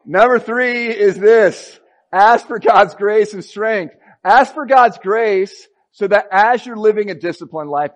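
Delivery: full-force sermon delivery; preaching at full force. Acted. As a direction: drive the point home to the congregation. Loud preaching of point three.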